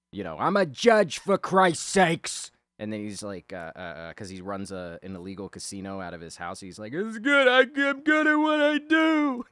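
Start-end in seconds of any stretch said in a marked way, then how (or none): 0.4-2.5 imitated outburst, high and loud; freaking out. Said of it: "he's freaking out". 6.9-9.5 high, loud imitated meltdown; losing his shit. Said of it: "He's losing his shit with his father".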